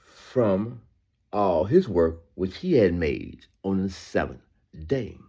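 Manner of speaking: very slow, drawn-out reading; slow scripture reading. Acted. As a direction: linger on every word. Rereads the verse slowly.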